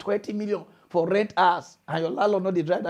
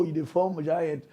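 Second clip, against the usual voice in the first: usual speaking voice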